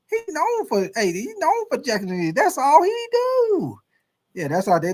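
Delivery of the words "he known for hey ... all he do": shrill, much higher mock voice, slowing on the end; sneering caricature of the haters; mocking critics. Host mimics critics dismissing Pete Carroll, high mocking sneer. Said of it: "that's how they gonna say about Pete Carroll".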